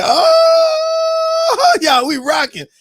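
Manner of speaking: high, loud excited whoop; hype shout. Retold or imitated. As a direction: whoop it like the party's peaking. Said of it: "I said".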